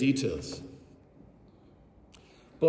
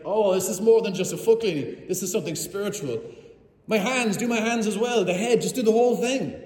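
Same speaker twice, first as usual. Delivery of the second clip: higher and louder, bursting with eagerness; all in, wash everything, please; eager excitement; 6 semitones higher, 4 dB louder than usual. Retold or imitated. Peter begging Jesus to wash all of him, excited.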